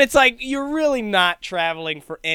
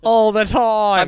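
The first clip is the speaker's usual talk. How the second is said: mock Aussie backpacker; 5 semitones higher, 9 dB louder, slower than usual. Continuing backpacker bit.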